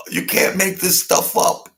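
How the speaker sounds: high, loud laughing disbelief; cracking up at his own joke; laughing hard. Real, not acted. Vlogger to viewers, laughing in disbelief.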